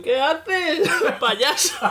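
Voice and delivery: very high, laughing telling-off; hammed-up laughing rebuke; mock scolding. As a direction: squeal it at a cheeky friend. Scolding teammates who run ahead.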